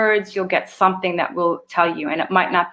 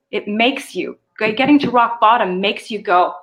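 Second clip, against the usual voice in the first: ordinary talk, nothing marked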